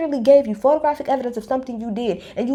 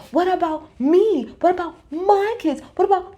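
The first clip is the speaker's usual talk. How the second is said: mock whiny me-me; 8 semitones higher than usual. Imitating Jen always making it about herself, high and whiny. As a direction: drag out the whining.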